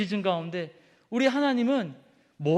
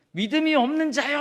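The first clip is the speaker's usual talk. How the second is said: higher, louder stern rebuke; mock rebuke; 7 semitones higher, 4 dB louder than usual. God rebuking a faithless servant, stern and raised. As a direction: scold like a disappointed master.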